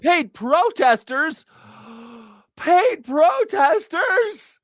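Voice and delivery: high, slow, gasping "Paid protesters?"; hammed-up outrage at "paid protesters"; mock shock. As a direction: ham up a scandalised gasp. Mock gasp at claim.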